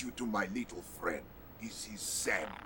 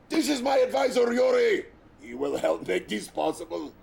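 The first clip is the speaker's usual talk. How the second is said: normal talking voice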